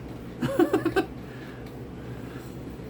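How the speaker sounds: soft airy high chuckle; wheezy self-mocking laugh; breathy laugh. Real, not acted. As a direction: laugh it off at yourself. After calling himself cranky.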